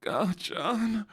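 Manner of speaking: high anime hero voice, placed further back; Deku. Shown as a demonstration. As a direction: call the name high, from further back in the throat. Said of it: "Gachan's a little bit further back".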